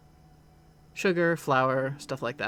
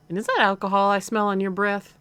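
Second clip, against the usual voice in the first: higher, louder Southern mom; slightly southern accent; 5 semitones higher, 6 dB louder than usual. Acted. Mom smells alcohol on her son, slightly southern accent. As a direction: confront him in a light Southern accent. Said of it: "like, a slightly southern accent".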